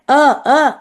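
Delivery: plain glottal آ, not ain; hamza sound. Shown as a demonstration. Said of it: "یہ حمزہ ہے". (حمزہ کی آواز آ)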